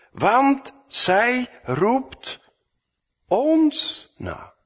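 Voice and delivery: high, very slow nagging complaint; mocking the disciples' grumble; mock whine. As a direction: stretch it out like a sulking disciple.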